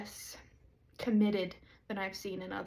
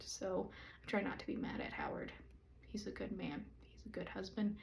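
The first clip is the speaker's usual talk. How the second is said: hushed joking whisper about her husband; a teasing whispered aside; playful whisper; 9 dB quieter than usual. Real, not acted. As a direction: drop to a whisper like sharing a secret.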